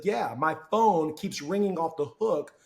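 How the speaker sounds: slow, complaining manager; a mild, slow complaint to the new guy; annoyed manager. Retold or imitated. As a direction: drag it out like a grumbling boss. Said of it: "He's like".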